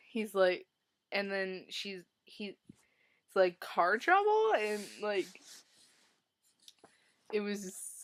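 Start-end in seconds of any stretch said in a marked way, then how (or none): 3.6-4.5 high, loud mock-innocent car trouble; teasing quip. Will teasing Lake after disconnecting her battery, hammed-up mock innocence. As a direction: tease her with a sly innocent jab.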